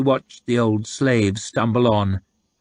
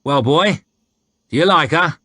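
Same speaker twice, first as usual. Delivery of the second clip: loud, higher jeering soldier voice; mocking soldier; 7 semitones higher, 5 dB louder than usual. A narrator's reading. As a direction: taunt him from the saddle.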